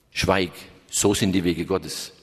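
low, slow solemn rebuke; stern companion. Acted. Companion rebukes the hermit, low and slow. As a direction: hush him with solemn, slow authority.

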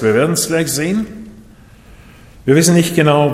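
usual speaking voice

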